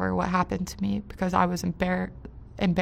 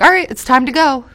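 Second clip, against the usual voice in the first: louder, higher, brisk order to leave; impatient girl; 6 semitones higher, 11 dB louder than usual. Retold or imitated. Imitating the girl hurrying them out, loud and brisk. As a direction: snap out the order to go. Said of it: "the one girl's like".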